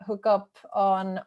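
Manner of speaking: ordinary talk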